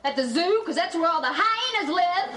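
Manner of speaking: offended, building to a jab; indignant retort. Acted. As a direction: throw the insult after him. Angry retort to departing boyfriend.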